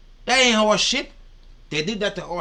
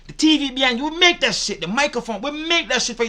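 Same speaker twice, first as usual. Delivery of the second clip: angry rant; 6 semitones higher, 5 dB louder than usual. Real, not acted. Ranting at the woman in the clip.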